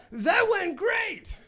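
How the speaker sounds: high, slow, loud mock cheer; mock triumphant shout. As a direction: belt it out like a proud winner. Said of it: "I've never said anything like".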